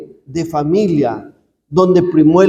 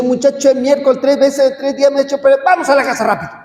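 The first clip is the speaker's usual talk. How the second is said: rushed, higher mock scold; hurried scolding mother; 8 semitones higher, much faster than usual. Priest imitating a mother scolding her lost boy in a rush. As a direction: rattle it off like a fed-up mom. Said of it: "Y no le dice".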